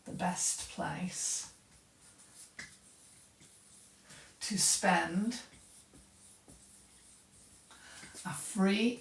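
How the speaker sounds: slow, quiet reading with long pauses between phrases; slow dictation. Shown as a demonstration. Teacher taking the class through a question, slow dictation. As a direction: read it out piece by piece, slowly and softly.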